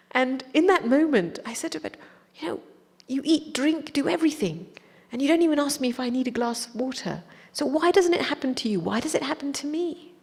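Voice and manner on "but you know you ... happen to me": high, pleading protest; indignant child. Retold or imitated. Disciple asking the teacher why it happens to her, indignant. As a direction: press the grievance like an affronted child. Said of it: "indignant child".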